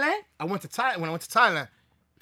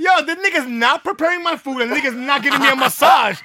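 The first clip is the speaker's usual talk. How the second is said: high, loud retold outrage; worked-up complaint; 6 semitones higher, 7 dB louder than usual. Arguing with his brother about the pitch, indignant. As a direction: protest indignantly, voice raised.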